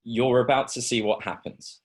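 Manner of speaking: usual speaking voice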